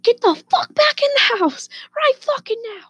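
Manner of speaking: very high mock-furious screech; high-pitched retold fury; imitated wife losing it. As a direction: mimic a wife ordering her husband inside. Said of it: "losing her shit on Steve".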